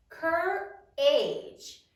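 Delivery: "Courage" in two separated beats, cur-age; syllable by syllable. Shown as a demonstration. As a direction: split "Courage" before a full AGE. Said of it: "How many syllables are there".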